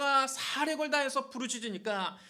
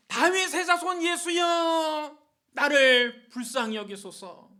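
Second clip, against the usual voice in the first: high, drawn-out shouted plea; loud cry; 4 semitones higher, 8 dB louder, slower than usual. Acted. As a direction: cry out slowly for mercy at full voice. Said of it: "큰 소리로 소리를 질렀습니다". (큰 소리로 길게 부르짖기)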